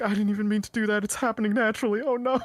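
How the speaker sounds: high, fast, helpless protest; flustered Guillermo. Retold or imitated. Friend retelling Guillermo's accidental kill, high and flustered. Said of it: "he's just like".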